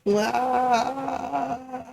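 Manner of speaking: sudden high strained "ah" cry; manic cry. Retold or imitated. Vlogger acting out his manic state to viewers, high frantic cry. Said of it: "I was just like".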